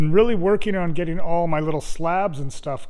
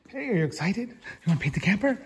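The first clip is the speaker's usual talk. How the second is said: higher, fast, bouncy questions to the dog; excited pet talk; 5 semitones higher, faster than usual. Acted. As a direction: hype the dog up about painting.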